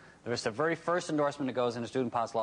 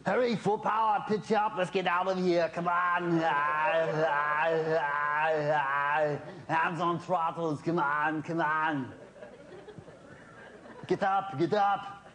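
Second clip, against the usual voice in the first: panicked under fire; 6 semitones higher, much slower than usual. Retold or imitated. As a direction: shout orders like a movie commando in trouble. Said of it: "Arnold Schwarzenegger as a flight instructor".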